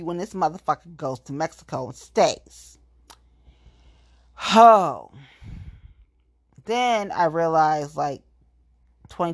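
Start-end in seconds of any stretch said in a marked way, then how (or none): 4.3-5.1 loud exclamation. Real, not acted.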